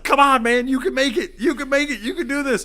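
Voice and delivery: high, loud mock coach cheer. Clowning on his friend, a mock high coach cheer. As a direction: hype him up like a fake coach. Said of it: "So now I'm clowning on him".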